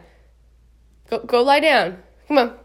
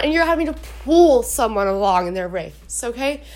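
ordinary talk

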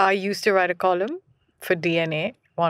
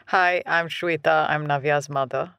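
dutiful and underwhelmed, dropping lower; unexcited; 6 semitones lower than usual. Real, not acted. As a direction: introduce yourself with no excitement at all. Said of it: "You don't sound so excited".

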